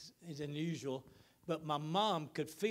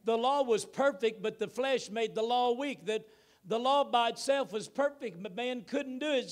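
full-force preaching; 7 semitones higher, 7 dB louder than usual. Acted. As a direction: proclaim it to the whole church. Preacher building the sermon loudly.

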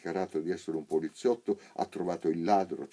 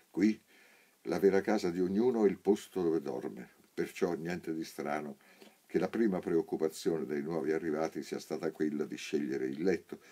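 ordinary delivery

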